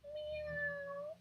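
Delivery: a frightened little squeak of a meow; tiny meow. A narrator's reading. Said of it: "it was more like a".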